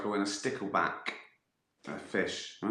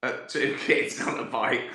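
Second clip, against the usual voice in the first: usual speaking voice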